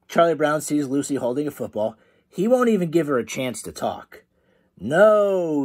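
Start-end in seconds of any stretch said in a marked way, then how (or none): 4.8-5.7 a raised, louder refusal; shouting. A narrator's reading. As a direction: Charlie Brown refusing Lucy outright, shouting. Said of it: "he shouts".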